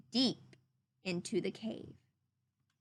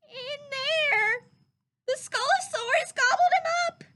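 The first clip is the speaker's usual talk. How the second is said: very high, louder, tearful squeak; a tiny frightened piglet wailing; sad Piglet; 14 semitones higher, 7 dB louder than usual. Acted. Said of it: "Piglet sadly said".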